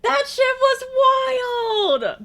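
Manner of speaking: high, very slow laughing shout; high drawn-out laughing shout; wild laughing yell. Real, not acted. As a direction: howl it out. Yelling laughing.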